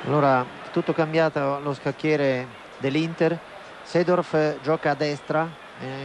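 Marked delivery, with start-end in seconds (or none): none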